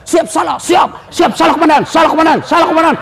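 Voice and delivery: mock policeman shouting siap salah; scolded policeman. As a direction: snap out apologies like a cowed officer.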